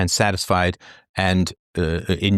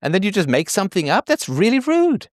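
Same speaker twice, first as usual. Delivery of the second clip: indignant; 8 semitones higher than usual. Real, not acted. Angry rebuke at listener.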